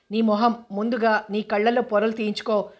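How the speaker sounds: plain reading voice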